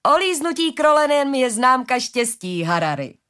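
put-on high, loud, slow old woman's call; drawn-out merry elderly cry, falling on the name; amused shout. A narrator's reading. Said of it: "křikla na něj stařena se smíchem".

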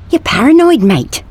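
anxious. A narrator's reading. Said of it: "looking anxiously over his shoulder".

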